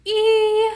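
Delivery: E sung high and loud; dramatic E. Shown as a demonstration. Showing a child the vowel, dramatic and high. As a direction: stretch the E wide. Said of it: "really dramatic with your mouth".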